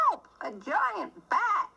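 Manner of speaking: hammed-up scare at a bat; mock frightened cry.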